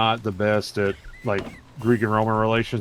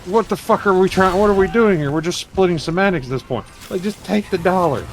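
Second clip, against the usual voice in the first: exasperated outburst; 8 semitones higher, 3 dB louder than usual. Retold or imitated. Retelling argument with parking attendant.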